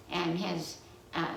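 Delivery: ordinary delivery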